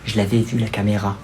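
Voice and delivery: low muttered aside; quiet aside. Acted. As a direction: drop to a sly undertone. Aside to camera.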